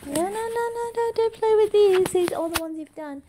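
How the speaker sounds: high, rapid no-no-no; hurried gentle scolding. Real, not acted. Parent stopping toddler playing with finished cards, hurried scolding.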